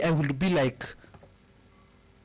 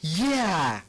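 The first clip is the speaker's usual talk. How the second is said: imitating mother; 6 semitones higher than usual. Retelling her mother's accusation, mock stern high voice. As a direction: play the fierce mum demanding the mistress. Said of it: "she was like".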